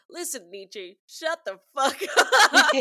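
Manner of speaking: Southern American impression building to a loud, drawn-out curse; Matthew McConaughey. Quoting True Detective to the co-hosts, loud mock Southern impression. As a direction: do a hammed-up True Detective cowboy drawl, breaking into laughter. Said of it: "like, Matthew McConaughey when he's like".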